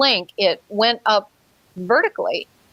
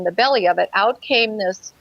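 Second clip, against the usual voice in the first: ordinary talk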